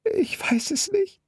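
very high, slow, tearful wail; sobbing. A narrator's reading. Girl sobbing to the dispatcher, lost. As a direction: let the words crack into tears. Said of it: "Das Mädchen brach in Tränen aus".

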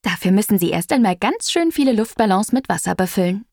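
usual speaking voice

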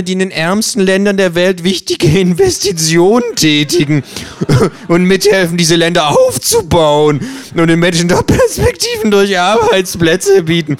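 mocking ad voice. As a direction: sneer through the slogan.

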